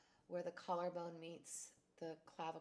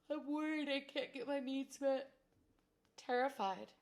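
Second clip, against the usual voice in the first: fearful outburst; 6 semitones higher, 7 dB louder than usual. Acted. Tapping script voicing fear.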